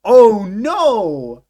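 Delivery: loud, high, exaggerated disbelief; hammed-up shriek of horror at each word; mock shocked outcry. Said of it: "is he gonna be like".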